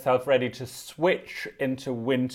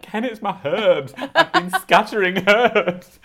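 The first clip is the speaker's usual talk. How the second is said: laughing confession; 8 semitones higher, 4 dB louder than usual. Retold or imitated. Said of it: "starts crying with laughter".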